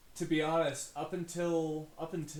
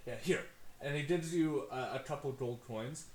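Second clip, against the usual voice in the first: ordinary delivery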